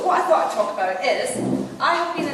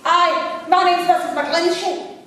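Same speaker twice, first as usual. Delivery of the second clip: loud, high Coatbridge woman; Coatbridge accent; 8 semitones higher, 6 dB louder, slower than usual. Retold or imitated. Caller giving her name to reception, loud Coatbridge accent. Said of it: "in her best Coatbridge accent".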